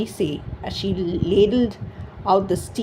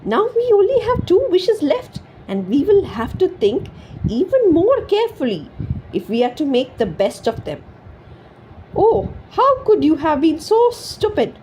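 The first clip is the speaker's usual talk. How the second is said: angry wife; 9 semitones higher than usual. A narrator's reading. Wife berating her foolish husband, high and angry. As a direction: scold him for his stupidity.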